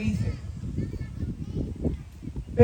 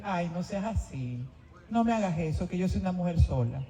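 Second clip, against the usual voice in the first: flirting; 3 semitones lower, 7 dB quieter than usual. Retold or imitated. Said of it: "yo le coqueteé".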